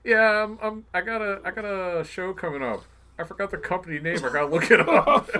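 high, loud eager mock guest voice; laughing mock guest; imitating an excited guest. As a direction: play the overexcited guy who forgot the name. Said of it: "He's like".

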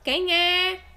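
loud, forgetting to disguise; wolf's undisguised voice. Acted. Wolf in grandma's bed answering the door. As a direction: blurt it in the wolf's own voice. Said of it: "esquecendo de disfarçar a voz".

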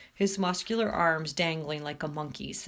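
ordinary delivery, nothing marked

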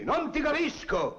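high, slow bandit voice; imitating a bandit, drawn out high; bandit's retort. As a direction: mimic the bandit who can't understand. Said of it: "diceva il bandito a me".

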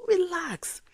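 high, loud exasperated relax; mock high "relax". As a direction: snap at her to calm down. God telling her to relax.

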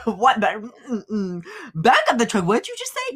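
rising, incredulous what-did-you-say; shocked disbelief. Retold or imitated. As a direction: react like someone who can't believe what she heard. Retelling her shocked reaction.

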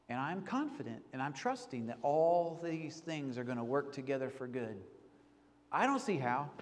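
soft, low trusting prayer voice; hushed prayer. Retold or imitated. Preacher voicing a trusting prayer to God, softly. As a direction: pray it quietly, close to a whisper.

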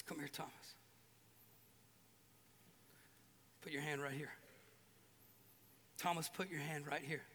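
soft, low, tender call; gentle invitation. Retold or imitated. Jesus gently inviting Thomas to touch his wounds, soft. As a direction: coax him close, gently.

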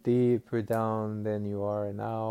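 ordinary talk, nothing marked

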